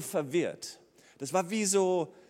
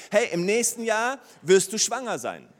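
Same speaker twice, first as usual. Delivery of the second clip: raised, higher proclaiming call; prophet's announcement; 5 semitones higher, 6 dB louder than usual. Retold or imitated. Elisha to the woman, a loud joyful announcement. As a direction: announce the promise boldly, louder.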